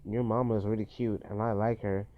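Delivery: ordinary talk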